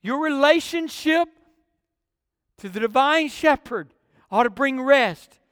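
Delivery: usual speaking voice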